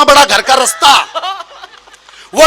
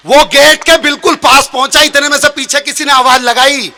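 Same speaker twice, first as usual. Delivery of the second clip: normal talking voice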